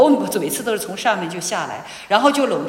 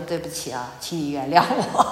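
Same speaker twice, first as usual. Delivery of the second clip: softer, lower, humble apology; a gentle plea for forgiveness; 7 semitones lower, 4 dB quieter than usual. Retold or imitated. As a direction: hand him the coffee and say sorry gently. Apologizing to her husband.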